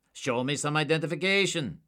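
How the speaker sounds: high, loud and sneering; scornful demand. A narrator's reading. Suspect demanding identification from the agents, scornful. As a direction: insist with open contempt.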